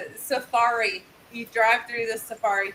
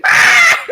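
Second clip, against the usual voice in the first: high, loud ostrich squawk; frustrated screech; 14 dB louder than usual. Retold or imitated. Storyteller imitating the trapped ostrich, frustrated screech. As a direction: blurt a piercing frustrated cry. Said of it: "he got frustrated 'cause he's like".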